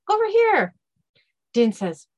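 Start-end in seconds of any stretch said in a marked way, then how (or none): 0.1-0.8 high, loud little call; high-pitched voice. Acted. Hidden captive calling for attention, high-pitched. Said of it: "another high-pitched voice says".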